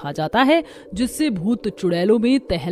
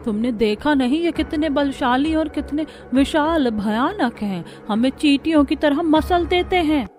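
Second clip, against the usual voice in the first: higher, anxious village voice; fearful villager, voice raised in alarm; frightened villager; 6 semitones higher than usual. Acted. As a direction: worry aloud as a scared villager. Frightened villagers discuss ghosts.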